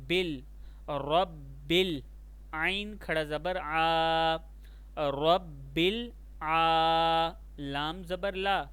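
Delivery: ordinary talk, nothing marked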